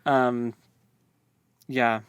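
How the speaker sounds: ordinary talk, nothing marked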